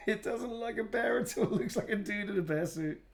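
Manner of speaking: high-pitched laughing talk. Real, not acted. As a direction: laugh through the words. Laughing at own drawing.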